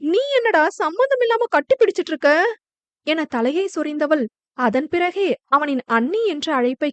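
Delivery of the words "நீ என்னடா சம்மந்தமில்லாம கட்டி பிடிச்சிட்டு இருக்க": high, fast, bewildered question; puzzled and quick; baffled protest. A narrator's reading. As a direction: demand to know what he is doing, pitched way up. Girl baffled by sudden hug.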